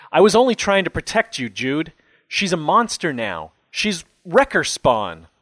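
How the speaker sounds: high, loud and fast, pleading; desperate plea. Acted. Father begging his son to understand, high loud desperate plea. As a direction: blurt it out to stop the blows.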